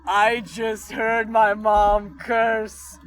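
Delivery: smug grin. Retold or imitated. Kid gloats to herself after hearing mom curse, slow smug grin. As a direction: savor it like you just won. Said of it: "with this grin of".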